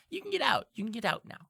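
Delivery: high creature voice, insistent; Diplin urging. Acted. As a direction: urge someone out as a little imp. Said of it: "He goes".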